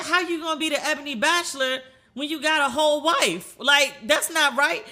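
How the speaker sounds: high, slowing at the end; mock scolding. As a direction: playfully call him out. Scolding dad playfully.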